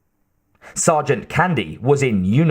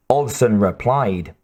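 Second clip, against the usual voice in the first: ordinary delivery, nothing marked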